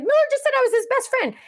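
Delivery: high, fast gush of news; squealy, rushed excitement; excited announcement. Retold or imitated. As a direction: re-enact breaking thrilling news. Retells excited text to family.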